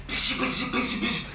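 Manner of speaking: high, soft mock whisper noise. Jordan passing garbled words to Wes, mock whispering noise. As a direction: imitate unintelligible whispering. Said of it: "then Jordan whispers to Wes".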